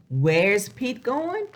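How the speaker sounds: ordinary delivery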